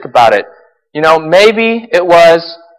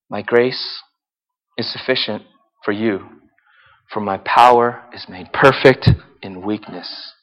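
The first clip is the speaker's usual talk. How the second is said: much softer, lower and slow, reverent; hushed reverent quote; 10 semitones lower, 9 dB quieter, slower than usual. Acted. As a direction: speak it softly like sacred words. Jesus' words quoted, hushed.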